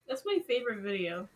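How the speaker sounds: ordinary delivery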